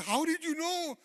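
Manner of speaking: high, loud mock-tearful cry; imitated crying outburst. Retelling the tough guy's reply to God's message, imitated crying outburst. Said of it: "He started crying".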